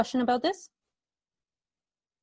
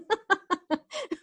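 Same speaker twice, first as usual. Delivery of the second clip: high giggle; 8 semitones higher than usual. Real, not acted. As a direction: keep giggling. Laughing at autocorrect answer.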